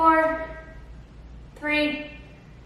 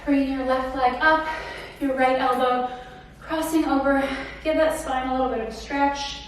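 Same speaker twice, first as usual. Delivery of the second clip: calm cool-down guidance; 6 dB quieter than usual. Acted. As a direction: ease them into the stretch. Leads the cool-down stretch.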